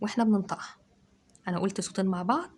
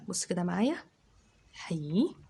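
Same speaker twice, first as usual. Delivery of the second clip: ordinary delivery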